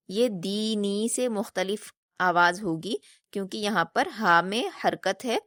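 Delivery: ordinary talk, nothing marked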